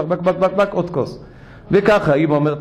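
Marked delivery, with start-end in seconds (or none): 0.0-1.2 sneak another gulp noisily; mock gulping noises. Imitating gulping wine.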